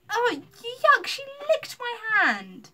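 high, loud, drawn-out squeal of disgust; revolted high yelp; cried out in disgust. A narrator's reading. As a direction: cry out, totally grossed out. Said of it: "she cried".

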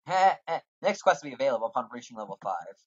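normal talking voice

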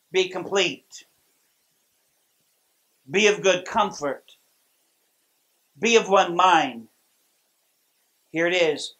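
unhurried pulpit reading of scripture. Acted. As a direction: read the verse with reverent pauses. Reads scripture aloud.

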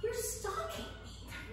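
urgent stage whisper to a friend; hushed aside. Acted. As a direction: lean in and hiss it under your breath. Aside to Enda about Tamara.

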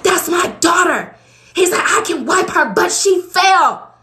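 high, loud protest from the ex; he pushes back, voice up high. Retold or imitated.

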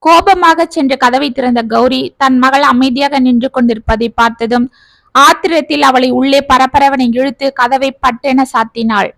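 usual speaking voice

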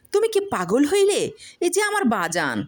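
high, startled disbelief; can't believe she's saying this; bewildered protest. Acted. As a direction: farmer pleading with his angry wife. Farmer bewildered.

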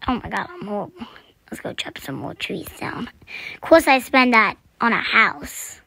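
ordinary talk, nothing marked

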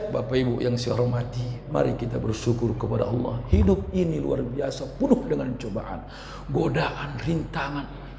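soft, low preaching demo; ceramah pelan. Shown as a demonstration. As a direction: preach gently, low and hushed, as a demonstration. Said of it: "tak bisa saya pelan ceramah". (ceramah dengan suara pelan dan rendah)

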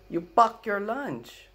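put-on high, slow Filipino accented repeat; Filipino grandma accent. Grandma repeating to grandson, slow and high, in a Filipino accent. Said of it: "And my grandma goes".

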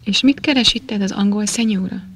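ordinary delivery, nothing marked

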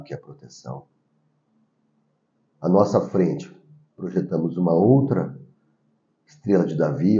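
hushed meditation leader; guided meditation. Acted. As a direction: guide them inward softly, with long pauses.

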